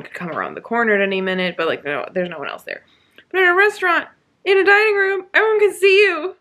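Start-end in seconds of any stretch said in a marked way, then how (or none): 3.3-6.4 higher and louder, incredulous; exasperated outburst. Real, not acted. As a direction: throw your hands up at the absurdity. Exasperated rant about a book scene.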